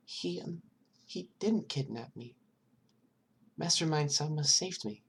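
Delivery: put-on high, timid small child voice; scared child answering a detective, high and hesitant; little girl voice. Eri corrects the detectives.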